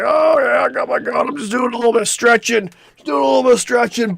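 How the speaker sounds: high, loud, dopey mock voice with a shout on "Oh"; careless gun guy. Mocking the idiot at the gun range in a high, loud dopey voice. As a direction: play the clueless guy waving his gun around.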